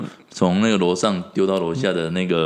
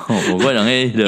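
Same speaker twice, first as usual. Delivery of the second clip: ordinary talk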